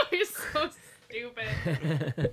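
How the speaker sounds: amused, breaking into laughter; laughing. Real, not acted. Host laughing at co-host's tombstone joke, laughing. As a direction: tease through a laugh.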